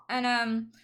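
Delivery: ordinary talk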